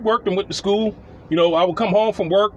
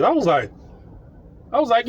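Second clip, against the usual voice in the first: ordinary talk, nothing marked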